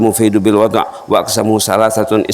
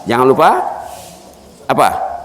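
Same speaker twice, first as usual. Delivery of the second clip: normal talking voice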